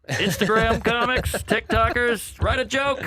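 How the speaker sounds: high, loud and slow call-out; a high hammed-up challenge; mock call-out. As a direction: yell it at the other comics. Calls out other comics.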